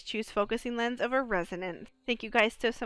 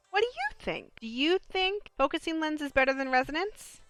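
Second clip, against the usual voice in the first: usual speaking voice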